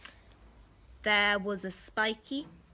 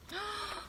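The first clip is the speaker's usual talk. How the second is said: sharp gasp; 9 semitones higher, 10 dB quieter than usual. Acted. Storyteller gasps at T. rex.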